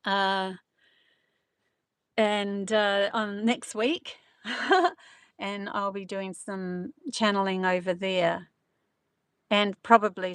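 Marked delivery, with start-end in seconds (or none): 4.4-4.9 short laugh. Real, not acted. Laughs about the trip.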